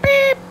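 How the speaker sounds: loud, high imitated censor beep; censor bleep. Gamer censoring his own swearing, high vocal bleep. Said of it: "I'm gonna bleep it from now on".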